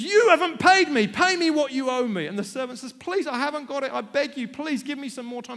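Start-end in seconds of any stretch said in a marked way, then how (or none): 0.0-2.3 very loud, high shouting at the debtor; furious demand. Acted. Servant grabs his debtor. 2.9-5.6 high, loud pleading; frantic begging. Acted. Debtor begs.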